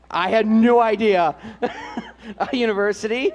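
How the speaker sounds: giggling at her own blunder; laughing. Real, not acted. Announcer laughing after mispronouncing the college. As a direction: laugh through the words.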